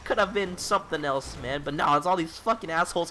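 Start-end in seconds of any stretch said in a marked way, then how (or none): none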